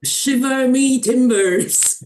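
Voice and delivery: slow, loud growling pirate. Pretend. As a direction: bellow it like an old sea captain. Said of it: "Spoken like a true pirate".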